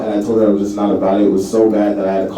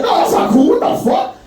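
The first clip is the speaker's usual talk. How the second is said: high, indignant outcry; outraged protest. Retold or imitated. Answering the roommate who shrugs it off, high indignant protest. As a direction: protest it's not cool. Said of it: "I was like".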